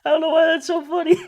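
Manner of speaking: high, fast, laughing through words; cracking up while talking. Real, not acted. Laughing at own zombie noises.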